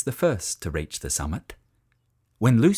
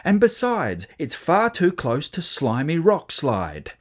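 higher, emphatic parental warning; insistent dad; 7 semitones higher than usual. A narrator's reading. Dad explains the danger to his children, insistent. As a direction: warn the children off firmly.

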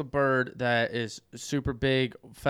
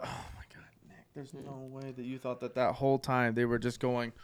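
incredulous whisper; 2 semitones lower, 10 dB quieter than usual. Real, not acted. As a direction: whisper it in disbelief. Whispered disbelief.

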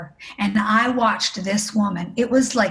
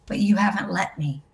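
slow, softer voice of God answering; gentle divine reply; 2 semitones lower, 5 dB quieter than usual. Retold or imitated. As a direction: answer tenderly, taking it slowly. Retells God's gentle reply.

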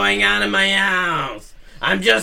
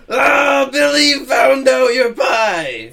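loud, slow startled shout; 6 semitones higher, 5 dB louder, slower than usual. Acted. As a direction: yell it out in alarm. Mom catches Billy at pie.

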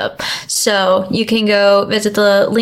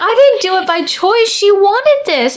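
defensive protest; 9 semitones higher than usual. Retold or imitated. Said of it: "I was like".

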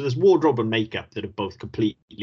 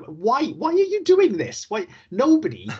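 exasperated disbelief; 11 semitones higher, 3 dB louder than usual. Real, not acted. Podcast host asking the film's hero why, in high exasperated disbelief. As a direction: wail at the screen in mock despair.

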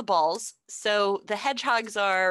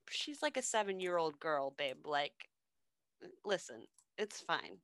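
much softer, lower calming murmur; calm the queen down gently; hushed husband placating his wife; soft soothing king; 3 semitones lower, 10 dB quieter than usual. Retold or imitated.

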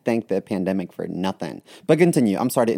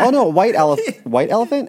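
sudden realization; 10 semitones higher, 6 dB louder than usual. Real, not acted. Suddenly remembers the right word.

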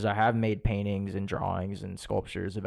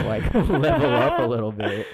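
laughing; 7 dB louder than usual. Real, not acted. Joking about mic distance.

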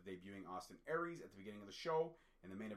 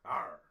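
a short loud pirate-ish growl; playful growl; 2 semitones lower, 10 dB louder than usual. Real, not acted. Growling after boasting of his Highland roots, playful. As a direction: let out a loud mock growl. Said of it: "I don't know why I growled there".

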